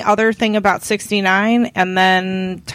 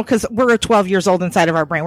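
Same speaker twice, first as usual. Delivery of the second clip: ordinary delivery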